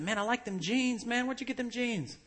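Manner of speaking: high-pitched admiring stranger impression; guy in line. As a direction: pitch it up as a chatty customer. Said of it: "this guy behind me in line said".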